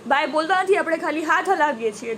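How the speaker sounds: usual speaking voice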